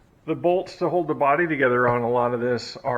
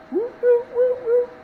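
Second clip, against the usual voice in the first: wiper whoop noise. Retold or imitated. Mimicking wiper sweep sound.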